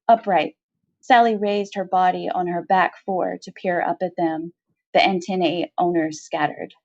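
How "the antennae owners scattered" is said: usual speaking voice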